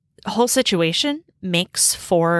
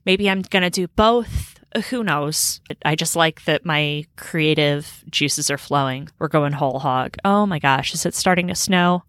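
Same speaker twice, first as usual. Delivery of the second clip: usual speaking voice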